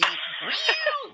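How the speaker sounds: squealing giggle; high flirty giggle. Acted. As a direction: giggle like a smitten lover.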